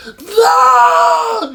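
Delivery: long, high wailed shout; mock bawling. Nine-year-old denied his favorite show, hammed-up bawling. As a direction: howl it like a defeated child. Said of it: "And I start bawling".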